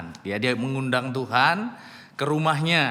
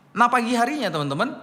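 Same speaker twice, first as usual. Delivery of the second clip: ordinary delivery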